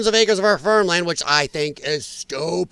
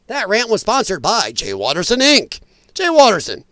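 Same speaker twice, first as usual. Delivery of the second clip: mock announcer; 3 semitones higher, 4 dB louder than usual. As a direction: belt it like an ad announcer.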